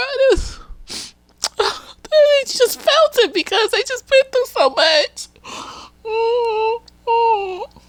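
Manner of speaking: very high, choked crying voice; emotional, tearful. Real, not acted. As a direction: squeak it out through tears. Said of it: "I was so emotional".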